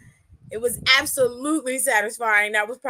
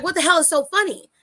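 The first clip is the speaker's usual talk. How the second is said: higher, fast mock outrage; mock angry demand; 6 semitones higher, 4 dB louder, faster than usual. The Miz retold, angry at Adam laughing. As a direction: throw the question out in mock anger. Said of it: "And he's like".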